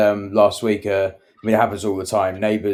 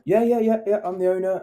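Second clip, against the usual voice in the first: higher, eager and quick; eager high yeah yeah; 11 semitones higher, 4 dB louder than usual. Retold or imitated. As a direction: blurt it out brightly. Client answering the neighbour.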